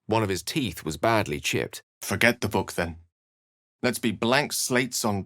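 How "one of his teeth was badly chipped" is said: ordinary talk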